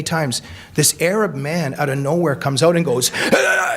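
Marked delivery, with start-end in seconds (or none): 3.3-3.8 a sudden high yelp; mock scream. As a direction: imitate the man bursting out screaming. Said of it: "and starts screaming".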